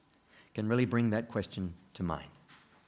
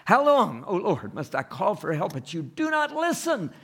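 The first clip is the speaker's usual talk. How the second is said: high, loud pleading cry to God; anguished cry; 8 semitones higher, 4 dB louder than usual. Acted. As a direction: cry out like a desperate prophet. Preacher repeats Habakkuk's cry.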